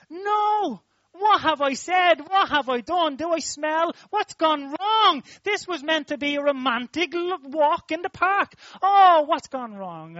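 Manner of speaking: hammed-up dismay, as if he's blown the date; panicked thoughts. Preacher imitating his own panic when Orla screamed, hammed-up and loud. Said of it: "I thought to myself".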